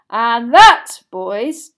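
loud, punched fatherly call; yelling. A narrator's reading. Father to his boys over the tumult, yelling. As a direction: yell over a noisy crowd. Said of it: "yelled Mister Weasley over the tumult".

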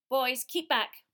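sharp loud bark; barked order. A narrator's reading. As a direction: snap the command at the boys. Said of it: "barked Professor Grubbly Plank".

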